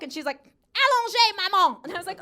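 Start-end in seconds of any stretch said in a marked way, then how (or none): 0.8-1.8 put-on loud, high little-kid order in French; demanding child. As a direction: imitate a bossy toddler calling to mom. Toddler demands her coffee.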